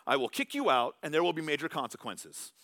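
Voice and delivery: loud, high stern dad warning; angry dad threat. Retold or imitated. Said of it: "you've heard your dad say it".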